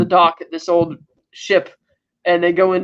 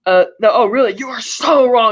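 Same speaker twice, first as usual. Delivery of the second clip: louder, higher mock indignation; retold anger; 5 semitones higher, 4 dB louder than usual. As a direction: imitate a villain losing his temper, leaning on "so". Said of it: "he finally gets angry".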